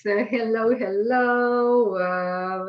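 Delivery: ordinary talk, nothing marked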